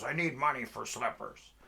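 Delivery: high, loud street-oddball voice; demanding panhandler. Retold or imitated. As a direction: press loudly for a handout. Slipper panhandler demands money.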